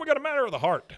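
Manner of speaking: high, loud mock proclamation; hammed-up announcement. Host voicing the star telling the crowd, high loud mock proclamation. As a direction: declare it grandly.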